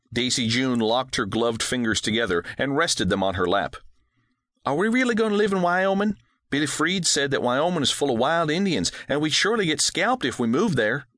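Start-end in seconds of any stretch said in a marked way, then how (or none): 4.6-11.1 put-on high, fast girl's voice, eager; an eager young girl asking quickly; young daughter full of questions, higher; excited little girl. A narrator's reading.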